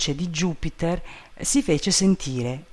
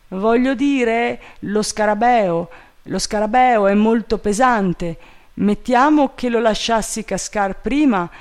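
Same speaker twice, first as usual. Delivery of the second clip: higher-pitched servant voice; pleading servant Jupiter; 7 semitones higher than usual. A narrator's reading. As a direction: explain nervously, lightly acted. Voices the servant explaining his worry.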